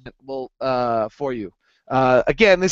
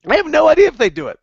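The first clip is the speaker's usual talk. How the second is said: loud; 12 semitones higher, 5 dB louder, faster than usual. Real, not acted. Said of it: "outburst after aside".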